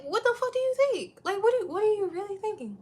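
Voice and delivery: incredulous. Real, not acted. Outraged rant about old ladies.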